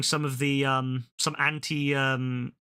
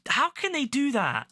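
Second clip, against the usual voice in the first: high exasperated cry; 10 semitones higher than usual. Real, not acted. Heartbroken outburst.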